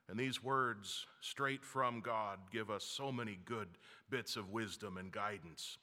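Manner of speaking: ordinary talk, nothing marked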